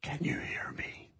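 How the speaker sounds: very soft, voiceless whisper. Shown as a demonstration. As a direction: whisper it as a test of dynamics. Dynamics demo, very soft.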